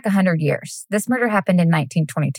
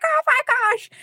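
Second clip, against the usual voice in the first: very high-pitched delighted exclamation; retold excited squeal. Host reliving seeing her friend's engagement pictures, excited squeal.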